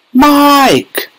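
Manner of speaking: high-pitched mother's scream. A narrator's reading. Mother calls for her vanished son, high scream. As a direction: scream the name high, without full volume. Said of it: "screamed Missus Teavee".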